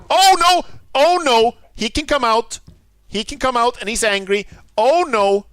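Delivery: loud, high panicked yelps; panicked cry. Real, not acted. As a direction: squeal as the golem charges. Golem breaks out angry.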